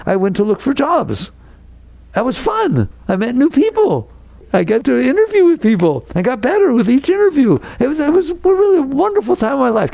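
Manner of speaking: high, bright-eyed voice of someone loving the job hunt; happy job seeker. Acted. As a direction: gush like it was the best time of life. Same figure describing job hunt.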